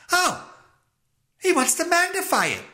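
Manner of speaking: high-pitched mock realisation; mocking the parents. Host mimics the parents' thought, in high-pitched mockery. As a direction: squeal it high, making the parents sound clueless.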